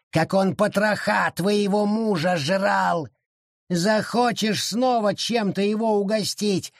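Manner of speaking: vicious gloat; taunting forest spirit. A narrator's reading.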